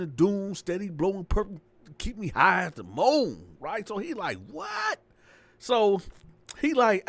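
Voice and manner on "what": startled what. Retold or imitated. Landlord reacts to the noise.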